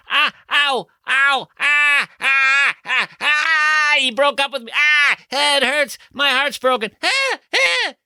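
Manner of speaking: high, loud mock wailing song; hammed-up wailing. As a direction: wail like a heartbroken pop star. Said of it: "wrote a song that said".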